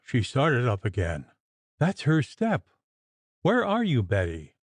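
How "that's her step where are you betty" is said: high, frightened call without real volume; a mild shriek. Acted. Mistress calling for Betty in fright, mildly shrieked. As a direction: call out in fear. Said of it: "she shrieked".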